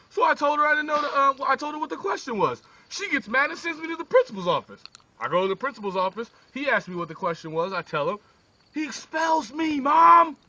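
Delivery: high, agitated complaining; a kid spilling it all to mom; upset whining. Acted. John pleads to his mom.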